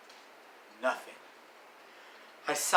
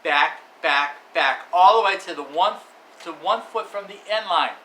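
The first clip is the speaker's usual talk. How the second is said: much louder, higher game call; excited play-by-play; 6 semitones higher, 10 dB louder than usual. Acted. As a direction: build the hype of the huge throw.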